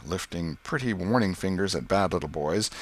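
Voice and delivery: ordinary delivery, nothing marked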